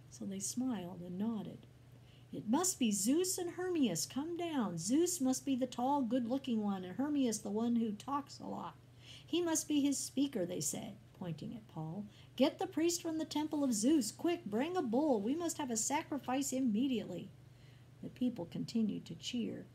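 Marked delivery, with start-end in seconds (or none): 2.3-10.4 loud, high-pitched exclaiming; excited crowd. A narrator's reading. Excited crowd mistaking men for gods. 12.4-17.3 high, loud, hurried orders; urgent excitement. A narrator's reading. Crowd urgently calls for a sacrifice.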